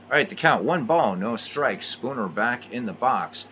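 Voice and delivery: brisk radio ballgame call; live baseball commentary voice; play-by-play. Acted. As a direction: call every pitch like a radio announcer. Resumes play-by-play.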